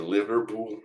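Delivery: Liverpool said in a British friend's fake American accent; mock American accent. Streamer mocking Spanish commentators' Liverpool, American accent. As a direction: imitate a Brit faking an American accent. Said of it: "when my British friends try and do American accents".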